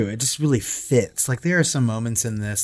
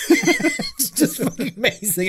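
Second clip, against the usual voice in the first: laughing through the words; can't stop giggling at the memory; 13 semitones higher than usual. Real, not acted. Co-host cracks up recalling Stewie, laughing. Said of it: "It makes me laugh thinking about it".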